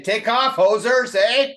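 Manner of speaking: a playful jab in a mock Canadian accent. Host jokes what the Canadian coach would say, mock Canadian accent. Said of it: "I expected Canada to say".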